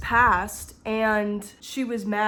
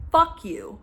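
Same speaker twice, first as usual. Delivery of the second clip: angry outburst; 7 semitones higher, 5 dB louder than usual. Real, not acted.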